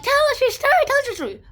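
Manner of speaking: high, loud begging chant; eager pleading. Retold or imitated. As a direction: nag him to tell the story. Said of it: "they're all like".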